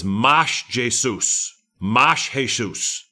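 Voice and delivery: "Mas Jesus" said mahsh ZHAY-soos, slowly; Portuguese phrase. Shown as a demonstration. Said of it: "I think that's right in Portuguese".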